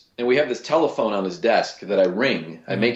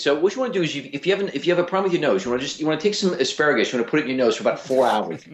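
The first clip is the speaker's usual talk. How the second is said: rapid-fire, higher-pitched TV doctor patter; 6 semitones higher, much faster than usual. Acted. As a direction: rattle off quick remedies, tripping over restarts. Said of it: "he talks really fast".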